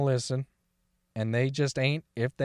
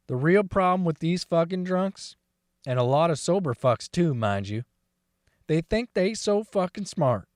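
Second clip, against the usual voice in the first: usual speaking voice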